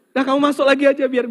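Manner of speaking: high, loud and fast; fast comic husband. Acted. Husband telling the jinn about twenty years of marriage, high, loud, fast. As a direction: rattle it off in comic despair.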